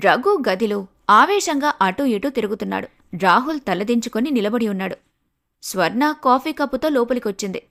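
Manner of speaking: ordinary delivery, nothing marked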